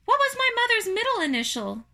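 high, quick spider voice; eager small creature, high and loud; second little spider. Acted. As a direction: ask fast in a tiny squeak.

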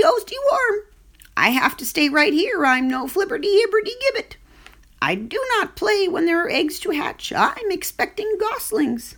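flustered mother goose guarding her eggs; stammering goose. Acted. Goose voiced in story.

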